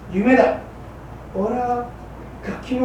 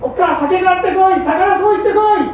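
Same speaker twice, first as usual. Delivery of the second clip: imitated bossy orders; 9 semitones higher, 6 dB louder than usual. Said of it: "wife quoting husband".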